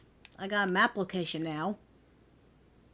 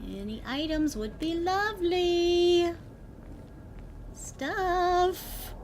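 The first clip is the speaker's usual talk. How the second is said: ordinary talk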